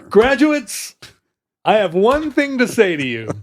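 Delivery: high, grand commencement speaker voice; earnest graduation speech. Pretend. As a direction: address the graduates like a solemn speaker. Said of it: "graduation speech?".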